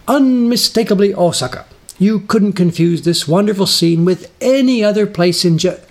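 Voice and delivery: high, loud TV presenter gush; hammed-up cheery host opening a travel show; over-the-top enthusiasm. Presenter to camera on a street, hammed-up enthusiasm. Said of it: "in the most enthusiastic voice I could muster".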